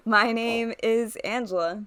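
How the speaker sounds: ordinary talk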